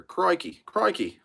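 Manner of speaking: high, loud mock Australian Crikey, crikey; deliberately terrible Australian twang, played for laughs; bad Australian accent. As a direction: ham up a clumsy Aussie accent. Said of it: "my really bad version of an Australian accent".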